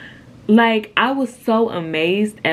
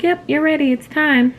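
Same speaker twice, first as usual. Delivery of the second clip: high, bright nurse voice, louder; cheery nurse voice; 11 semitones higher, 4 dB louder than usual. Retold or imitated. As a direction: play the upbeat nurse announcing it. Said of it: "they're like".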